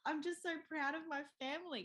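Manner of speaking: imitating a husband choked up with pride; high, weepy pretend gushing; mock crying. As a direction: blubber it tenderly, hammed up.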